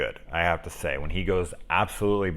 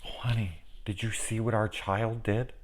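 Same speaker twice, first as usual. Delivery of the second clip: much softer, sweet proud tone; sweet proud parent; 8 dB quieter than usual. Retold or imitated. Proud parent sweetly telling their spouse. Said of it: "an accomplishment".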